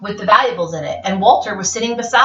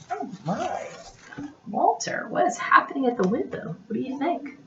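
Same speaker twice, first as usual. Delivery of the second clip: hushed suspense; 4 semitones higher, 10 dB quieter than usual. A narrator's reading. Suspenseful quiet questions to child.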